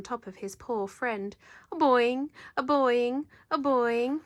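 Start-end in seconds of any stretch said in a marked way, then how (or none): 1.7-4.2 bouncy boing. Acted. Whales bounce on the ice.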